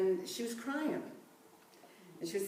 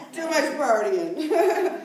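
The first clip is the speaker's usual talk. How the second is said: slow, laughing, higher and louder; 8 semitones higher, 6 dB louder, slower than usual. Real, not acted. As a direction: chuckle through the joke. Laughing joke about mom.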